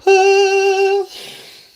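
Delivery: loud high exasperated groan-sigh; frustrated sigh. Real, not acted. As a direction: let the frustration out in one big sigh. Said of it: "I'm getting frustrated".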